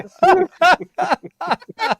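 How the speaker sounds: high, loud burst of laughter; laughing hard at a friend's joke. Real, not acted. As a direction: crack up at the joke.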